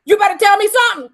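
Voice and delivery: high, loud, rapid demand; reenacted urgent demand. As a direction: fire it out like an alarmed parent. Alarmed parent demanding answers, reenacted.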